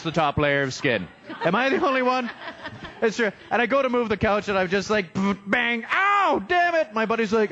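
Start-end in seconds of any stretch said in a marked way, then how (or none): 5.5-6.9 high yelp of pain, cut short; mock pained yelp. Comic retelling of moving a couch, hammed-up yelp of pain. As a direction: bang and ow, hammed-up hurt.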